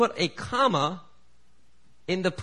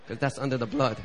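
ordinary delivery

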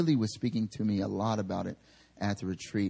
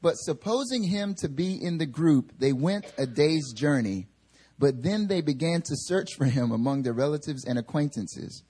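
higher, measured reading voice; scripture reading; 6 semitones higher than usual. Acted. Preacher reading Luke aloud to the church, measured reading. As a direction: read the passage to the congregation.

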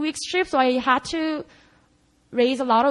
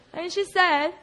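usual speaking voice

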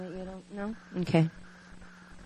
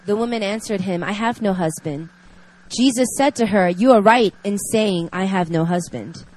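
reading scripture; 4 dB louder than usual. Acted. Reads scripture passage aloud.

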